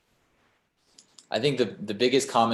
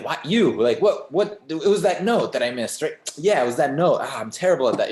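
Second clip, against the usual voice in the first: high, louder fretting at yourself; hammed-up inner critic, pitched up; acted self-reproach; 6 semitones higher, 5 dB louder than usual. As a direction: replay the mistake in mock panic. Acts out obsessive self-criticism after concert.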